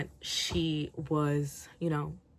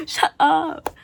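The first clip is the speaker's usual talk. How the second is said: exasperated; 10 semitones higher, 10 dB louder than usual. Real, not acted. Own exasperation, loud.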